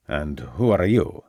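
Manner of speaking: put-on a guarded servant with a light Italian accent; lightly voiced Italian manservant. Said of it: "in what Ravenscroft judged to be an Italian accent".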